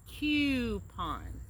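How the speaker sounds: Coupon crisp and clear, kyoo-pahn with sharp k and p; polished travel-agent Coupon; careful standard American Coupon; professional coupon. Shown as a demonstration. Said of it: "Southern versus professional takes".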